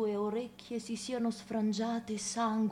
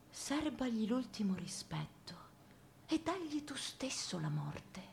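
softer, grave whisper; 8 dB quieter than usual. Acted. Woman advising to kill the dog oneself, whispered. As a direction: murmur the grim counsel. Grim advice on killing the dog.

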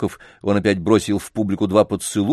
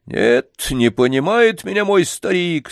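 disgruntled dog; 9 semitones higher, slower than usual. A narrator's reading. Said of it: "в этом недовольном лае".

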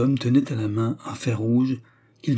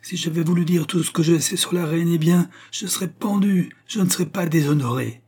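high, impassioned protest; defiant outcry; 7 semitones higher than usual. A narrator's reading. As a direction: press on urgently in a raised pitch. Jeanne cries revelations over her silencers. (protestation aiguë et véhémente)

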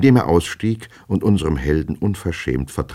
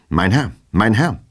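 calling out; 6 semitones higher, 4 dB louder than usual. A narrator's reading. Hero calls after his fleeing double, raised call. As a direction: call after him, higher and a touch louder. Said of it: "rief er Herrn Goljetkin".

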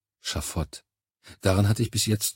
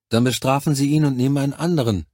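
higher-pitched voice of a blunt adviser; insistent Charlie; 5 semitones higher, 3 dB louder, faster than usual. A narrator's reading. Charlie telling the commander what to do, insistent. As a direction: give firm advice in a raised pitch.

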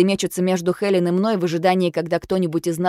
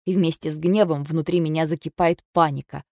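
ordinary delivery

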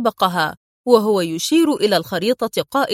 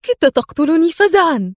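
higher-pitched, frightened outcry; startled exclamation; 7 semitones higher, 3 dB louder than usual. A narrator's reading. Woman to the man who scared her, crying out. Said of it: "تهز رأسها في قوة هاتفة".

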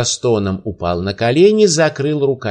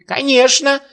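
put-on old man shouting; 13 semitones higher than usual. A narrator's reading. Old man shouting his claim before the emir, high. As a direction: fling it out high. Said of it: "закричал старик".

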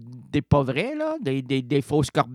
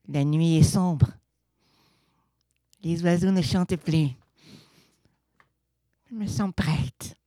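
melodramatic photo-novel reading. Acted. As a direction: read it like a cheesy romance caption.

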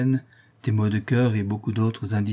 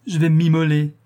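sobbing; 5 semitones higher, 5 dB louder than usual. A narrator's reading. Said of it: "dit-elle en sanglotant".

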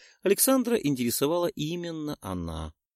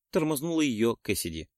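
ordinary delivery, nothing marked

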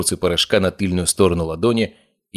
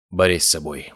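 ordinary delivery

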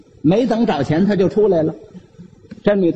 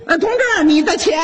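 calling out; 8 semitones higher than usual. Acted. Saleswoman calls after the uncle.

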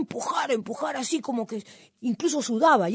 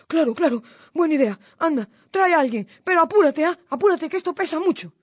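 loud, urgent, raised voice; flustered fox; 6 semitones higher, 6 dB louder than usual. A narrator's reading. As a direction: hurry him off anxiously. Frightened fox urges cuy to hurry.

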